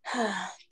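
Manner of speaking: quiet, heavy sigh; soft sigh. Acted. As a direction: let out a tired sigh. Sighing after a daydream ends.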